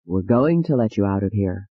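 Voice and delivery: low, gentle reassurance; soothing. Acted. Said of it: "Doctor Keller said soothingly".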